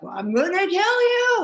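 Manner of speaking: high, mock-furious threat; exasperated mom joking about murder. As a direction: snap it out in mock rage. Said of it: "Like, just so frustrated".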